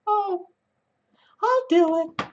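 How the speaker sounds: put-on disappointed kid giving in; little kid voice. Kid self voiced in story.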